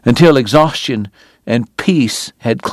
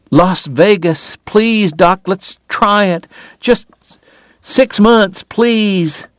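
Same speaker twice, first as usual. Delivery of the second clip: tearful pleading; 8 semitones higher, slower than usual. A narrator's reading. Laughing through tears, begging.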